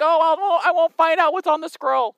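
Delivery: mock petty whine. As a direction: play a shallow disappointment, tongue in cheek. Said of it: "he's like".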